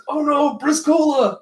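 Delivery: very high cartoon cry of dismay; high-pitched hammed-up distress; mock alarm. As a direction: wail out in pretend horror. Henchman cries out for slammed ally.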